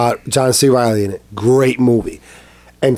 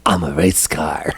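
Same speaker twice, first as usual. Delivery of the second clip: low mock voice, deadpan joke; mock goofy voice; 10 semitones lower, 5 dB quieter than usual. Friend retelling a TikTok guy's line, deep mock voice. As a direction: drop low for the punchline. Guy on TikTok after farting.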